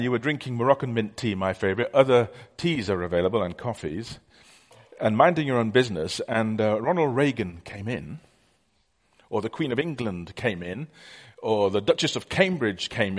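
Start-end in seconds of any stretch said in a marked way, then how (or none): none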